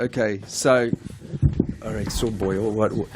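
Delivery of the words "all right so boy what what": low, uncertain Welsh accent; feeling for a Welsh accent; attempted Welsh accent. Shown as a demonstration. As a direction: try out a shaky Welsh accent. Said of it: "auditions I ever did was doing Welsh".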